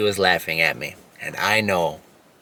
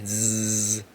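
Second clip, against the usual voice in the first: buzzing like a bee; 2 semitones higher than usual. Shown as a demonstration. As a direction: buzz like a bee through the teeth. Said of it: "bee breathing".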